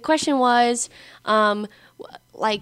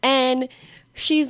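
usual speaking voice

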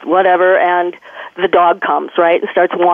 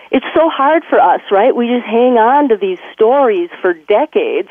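ordinary delivery

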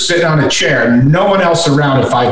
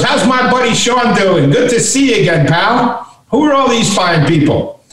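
higher-pitched, hearty retold greeting; lightly voiced senator; 8 semitones higher than usual. Senator wheels up to greet his buddy, lightly voiced greeting. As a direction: lightly act out a friendly senator's hello. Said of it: "He goes".

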